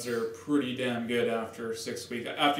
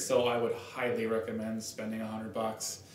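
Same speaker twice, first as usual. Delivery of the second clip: normal talking voice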